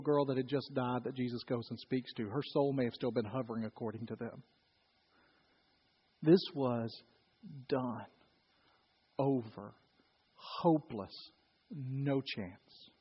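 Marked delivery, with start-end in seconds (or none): 6.2-12.9 low, hushed, with long pauses between words; grave and hushed. Real, not acted. Preacher telling the congregation all hope was gone, grave and hushed. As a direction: let each word drop softly into silence. Preacher dwells on a hopeless death.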